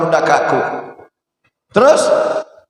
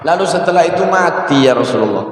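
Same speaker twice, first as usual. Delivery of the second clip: usual speaking voice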